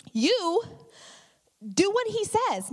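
high, slow, emphatic order; firm command. Retold or imitated. Mary ordering the servants: high, slow firm command. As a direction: lay down the law like a mom. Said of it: "and she says".

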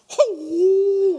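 high, loud mock martial-arts yelp; kung fu cry. Preacher bracing as Becky runs at his car, mock kung fu yelp. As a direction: brace like a kung fu fighter and yelp. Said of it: "kung fu".

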